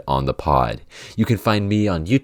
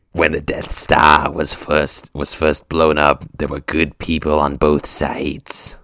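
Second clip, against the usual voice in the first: mock politics in an evil emperor's rasp; Sith lord voice; 2 semitones lower, slower than usual. Comic doing politics in the Sith villain voice, slow rasp. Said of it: "I'm impressed".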